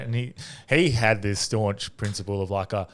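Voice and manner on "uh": ordinary talk, nothing marked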